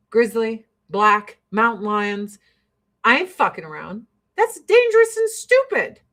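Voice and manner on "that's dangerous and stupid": higher, louder and hammered; emphatic verdict. Real, not acted. Scolding hikers who ignore danger, emphatic verdict. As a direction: spit out the verdict hard.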